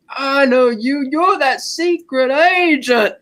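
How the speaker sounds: high, slow, whiny drawl of an outraged sheriff; angry Forrest Gump. Retold or imitated. Sheriff Pepper recognizing Bond, slow outraged drawl. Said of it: "sounds like an angry Forrest Gump".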